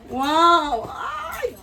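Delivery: high, loud wow then a squealing ay; thrilled surprised squeal; delighted squeal. Real, not acted. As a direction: gasp and squeal at the present. Opening coffee gift.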